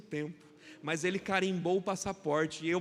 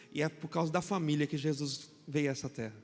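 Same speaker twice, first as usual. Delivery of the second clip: usual speaking voice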